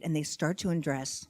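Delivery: ordinary delivery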